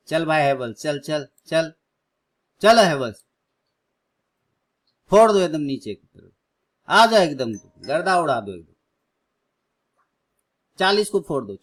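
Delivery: loud, rising cheers like a cricket fan; cheering the stock. Real, not acted. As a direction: shout encouragement at the screen. Cheering Havells stock to fall. (जोश में चिल्लाते हुए)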